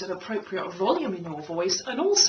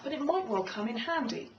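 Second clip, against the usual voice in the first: ordinary talk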